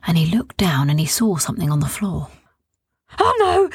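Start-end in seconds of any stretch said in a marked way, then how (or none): 3.2-3.7 high startled cry; frightened. A narrator's reading. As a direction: jump in fright. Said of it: "he jumped in fear with such fright".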